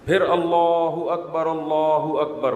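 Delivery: slow, measured short takbir; a short, measured azan; short azan demo. Shown as a demonstration. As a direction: call it briefly, without stretching. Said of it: "مختصر اذان دیں".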